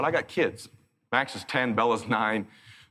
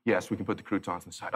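polite server voice; 9 semitones lower, 7 dB quieter than usual. Retold or imitated.

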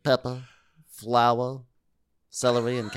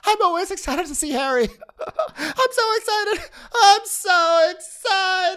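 much higher, slow, half-sung squeal; squealing excitement; 7 dB louder than usual. Pretend. Co-host gushing over Harry in a giddy voice. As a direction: sing it like a giddy fan.

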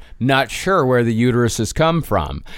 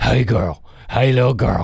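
dog voice; 4 semitones lower than usual. Retold or imitated. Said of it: "It's more".

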